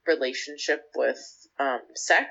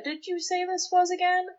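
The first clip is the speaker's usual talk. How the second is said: much higher, alarmed mom voice; a mother suddenly panicking; freaked-out mom; 13 semitones higher than usual. Retold or imitated. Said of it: "The mom kinda freaks out".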